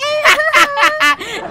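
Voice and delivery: high, gleeful prankster cackle. Acted. Prankster watches the cat panic, gleeful high cackle. Prankster laughs at victim's fright.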